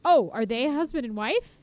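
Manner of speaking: high, loud burst of surprise; surprised question. Real, not acted. Streamer reacting to a couple in the cutscene, surprised.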